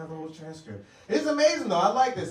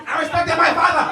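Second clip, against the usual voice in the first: high, loud proud retort; proud father; 9 semitones higher, 12 dB louder than usual. Retold or imitated. Immigrant dad boasting to his son.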